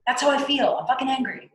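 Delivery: high, emphatic angry declaration; validated anger. Acted. Teacher voicing someone validating their own anger, emphatically angry. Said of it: "and to validate it".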